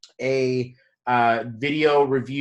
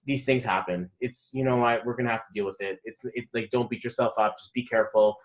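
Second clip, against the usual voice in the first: low, soft, understated reassurance; calm energy; 5 semitones lower, 6 dB quieter than usual. Retold or imitated. Man telling the driver not to beat herself up, calm energy. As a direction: talk her down without any fuss. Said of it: "Like, energy as well".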